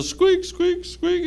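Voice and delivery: high squeaky mouse noises; mouse squeaks. Acted. Narrator imitates mouse squeaking.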